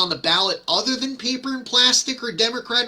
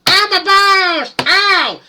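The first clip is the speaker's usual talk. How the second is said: high, loud yelp of pain; 12 semitones higher, 5 dB louder, slower than usual. Pretend. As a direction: cry out like you just got hit in the groin. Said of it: "usually utters the words".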